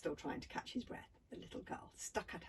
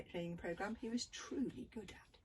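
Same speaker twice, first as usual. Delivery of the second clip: ordinary delivery, nothing marked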